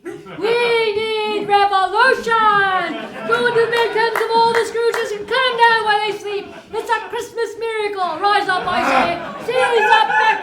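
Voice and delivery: fired-up rabble-rouser shouting for revolt; rallying cry. Acted. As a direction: rouse the crowd to rise up.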